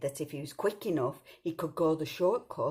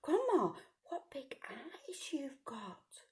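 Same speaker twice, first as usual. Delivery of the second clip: put-on slow hushed child whisper; wary whisper; 8 semitones higher, 6 dB quieter, much slower than usual. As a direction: whisper it with suspicion. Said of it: "she said".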